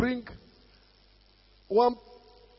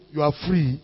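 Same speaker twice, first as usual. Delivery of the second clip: ordinary delivery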